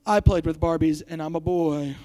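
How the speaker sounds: low, dejected imitated confession; mock worried boy. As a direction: drop it low in mock dismay. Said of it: "some of you are saying".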